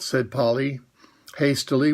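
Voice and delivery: ordinary delivery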